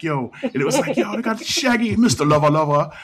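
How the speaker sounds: imitating a Jamaican accent; mock Jamaican accent. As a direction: put on a thick Jamaican accent, loud and bright.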